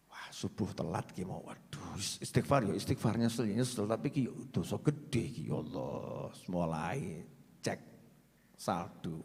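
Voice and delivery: low, hushed muttering sinner counting his balance; lazy mock repentance. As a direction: mumble like a man half sorry, eyeing his savings. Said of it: "ada yang lebih gampang". (gumam pelan orang menyesal setengah hati)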